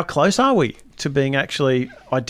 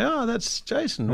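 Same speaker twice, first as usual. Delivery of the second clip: mock recognition; 11 semitones higher, 6 dB quieter, much slower than usual. Police system recognising a voice.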